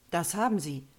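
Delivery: ordinary delivery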